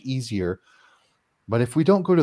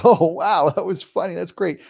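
higher, bright, laughing it off; amused; 7 semitones higher, 4 dB louder than usual. Retold or imitated. Homeowner laughing at the spirit: bright amused reply. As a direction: brush off the scare with a grin.

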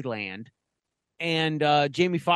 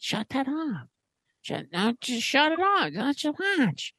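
higher-pitched retold scolding; imitação da amiga; 9 semitones higher than usual. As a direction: mimic an annoyed friend, pitched way up. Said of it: "the friend was like".

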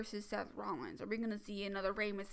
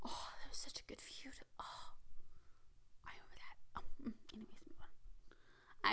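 nostalgic whisper; 11 dB quieter than usual. Real, not acted. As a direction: whisper fondly to yourself. Fond memory, hushed aside.

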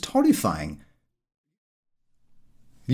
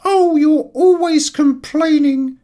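loud, high, slow insolent whine; rude retort; 8 dB louder, slower than usual. Acted. Servant Sarah answering her master rudely, loud and high. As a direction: snap back with cheeky contempt. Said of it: "Sarah answered very rudely".

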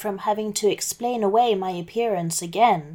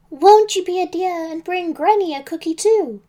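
high, raised plea as Granny; almost shouting; 9 semitones higher, 4 dB louder than usual. A narrator's reading. Wolf posing as Granny to Little Red, almost shouting. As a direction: plead high and a little too forcefully. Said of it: "almost shouted".

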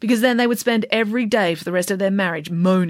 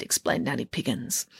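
ordinary delivery, nothing marked

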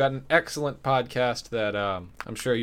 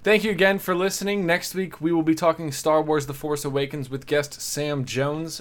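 bright, loud host sign-off, pitched up; podcast outro; 6 semitones higher, 7 dB louder than usual. Acted. Host thanking listeners, upbeat announcer outro. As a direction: wrap the show like a radio host. Host reads next week's plug.

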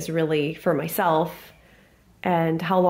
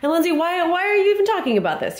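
high, fast puzzled listener voice; imitating a confused listener; 10 semitones higher, faster than usual. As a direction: play the baffled listener, pitched up.

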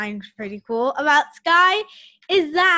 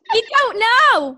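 loud; 8 semitones higher, 9 dB louder, slower than usual. Real, not acted. Excited outcry.

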